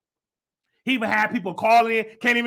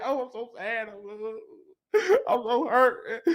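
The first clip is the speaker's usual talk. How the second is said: softer mock whimper with a laugh; mock crying; 6 dB quieter than usual. Mocking a crying man, soft mock whimper. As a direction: fake sad, half laughing. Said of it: "'cause he crying".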